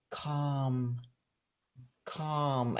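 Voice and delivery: hushed and soft, drawing out each calm; soothing imitated acupuncturist. Acupuncturist hushing his tense patient, soft and soothing. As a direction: soothe her like a calm healer. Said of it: "he has very, you know, broken English".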